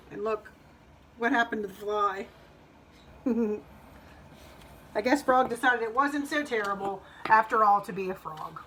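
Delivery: ordinary delivery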